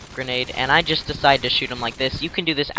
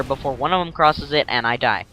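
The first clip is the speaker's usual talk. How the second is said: ordinary delivery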